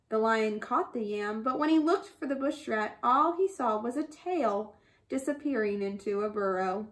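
plain reading voice

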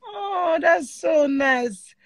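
high, drawn-out cooing thanks; touched, appreciative. Real, not acted. Host thanking caller for his love, touched. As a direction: gush it slowly, stretching the last words.

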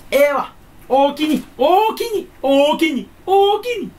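high, loud fake Kansai "おおきに" thanks; mock Kansai dialect. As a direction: ham up a fake Osaka shopkeeper. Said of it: "エセ関西弁". (高く大きい声のエセ関西弁)

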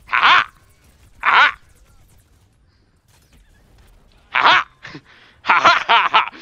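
high, loud bursts of "ha ha"; gloating laugh. Pretend. Player gloating over opponents, loud crowing laugh. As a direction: crow with loud staccato laughs.

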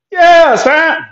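hype exclamation. Real, not acted. Gloating exclamation.

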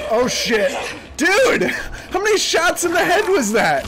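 high, loud panicked shout; panicked yelling. Real, not acted. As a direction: yell in sudden panic. Cop shoots him repeatedly.

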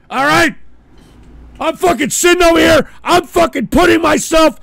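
high, loud, real ranting shout; furious rant. Real, not acted. Host raging at his audience before quitting, shouting. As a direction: unload on the whole audience.

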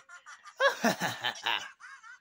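high goofy cartoon laugh. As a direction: cackle like a cartoon critter. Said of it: "this Crash Bandicoot voice".

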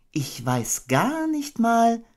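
high, slow musing mother voice; thoughtful Kanga. Acted. Kanga pondering a cold bath, thoughtfully. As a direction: wonder aloud, slowly. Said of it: "sagte Kenga nachdenklich".

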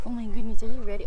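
ordinary talk

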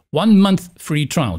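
ordinary talk, nothing marked

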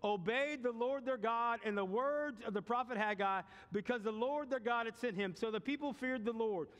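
rising, fervent pulpit preaching. Acted. Pastor preaching to the church, building fervor. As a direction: preach it with mounting fire.

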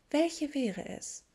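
high, slow airy voice; dreamy Luna. A narrator's reading. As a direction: ask it like from far away. Luna's dreamy question.

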